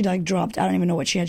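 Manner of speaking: ordinary talk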